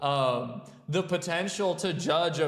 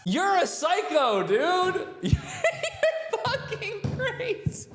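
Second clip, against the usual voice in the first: words squeezed out through real laughter, much higher; laughing disbelief; 11 semitones higher, slower than usual. Real, not acted. Host mocking the referee, laughing in disbelief. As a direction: laugh while insulting a friend.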